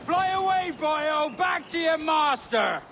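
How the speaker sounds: mocking jeer. Mocking the departing man.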